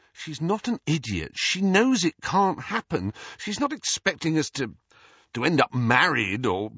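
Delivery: high-pitched, heated rebuttal; exasperated protest. A narrator's reading. Harry defends Ginny to Ron, exasperated. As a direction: argue it urgently, then break off.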